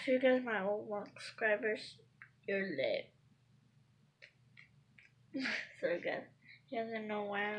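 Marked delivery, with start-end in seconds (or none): none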